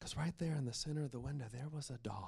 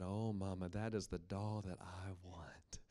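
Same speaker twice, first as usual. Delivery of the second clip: hushed longing; 5 semitones lower, 7 dB quieter than usual. Acted. Girl longing for doll.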